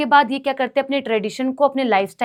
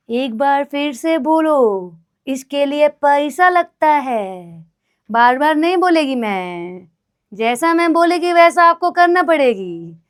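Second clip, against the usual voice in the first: mock bossy character; 3 semitones higher, 5 dB louder, slower than usual. Put-on bossy voice, broken grammar.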